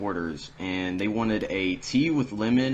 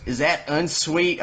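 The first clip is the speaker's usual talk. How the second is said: higher, drawn-out mock question; demanding customer; 8 semitones higher, 4 dB louder, slower than usual. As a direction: imitate a customer checking suspiciously, slowly. Customer challenges server, retold.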